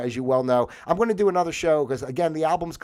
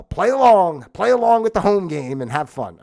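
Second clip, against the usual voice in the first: catchphrase sign-off; 5 semitones higher, 8 dB louder than usual. Acted.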